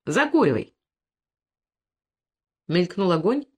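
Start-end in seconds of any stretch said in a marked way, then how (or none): 0.0-0.7 a sharp, curt snap; short sharp command to the robber; sharp retort. A narrator's reading. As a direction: cut him off sharply, a touch of menace. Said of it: "резко прозвучавшим в темноте голосом".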